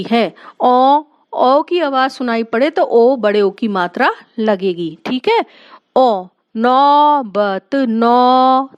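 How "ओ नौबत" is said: नौबत slowly, with the औ held long; stretched au. Shown as a demonstration. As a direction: draw out the औ in नौबत. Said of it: "दो मात्रा और एक डंडा".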